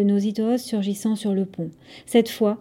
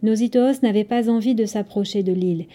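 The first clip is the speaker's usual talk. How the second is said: ordinary delivery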